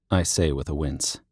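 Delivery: ordinary delivery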